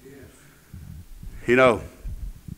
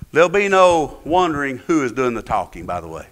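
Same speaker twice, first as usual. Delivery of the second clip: ordinary delivery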